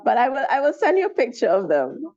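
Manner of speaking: ordinary talk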